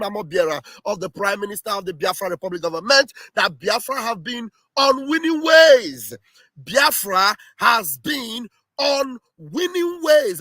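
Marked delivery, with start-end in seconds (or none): 3.4-10.4 "winning ways" stretched out emphatically; emphatic declaration. Real, not acted. Spokesman declaring Biafra on winning ways, emphatic. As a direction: declare it loudly, drawing out "ways". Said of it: "and this is emphatic".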